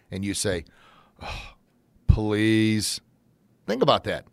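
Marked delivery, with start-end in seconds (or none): 1.2-3.0 sighed oh, then drawn-out level please; grudging reminded please. Shown as a demonstration. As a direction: say it reluctantly through a frown. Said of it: "like if someone reminded you to say".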